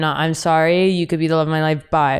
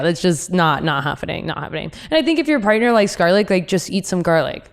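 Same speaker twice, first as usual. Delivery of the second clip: ordinary delivery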